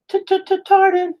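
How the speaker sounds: very high squeaky stutter on T-t-t-tarded; goofy falsetto stutter; silly stutter voice. Shown as a demonstration. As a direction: stammer the t's in a cartoon squeak. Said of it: "the way that you made it sound".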